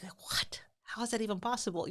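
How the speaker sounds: breathless whispered disbelief; shocked whisper. Retold or imitated. A parent reading the page whispers in disbelief. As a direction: gasp it out under your breath, stunned. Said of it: "and you're gonna be like".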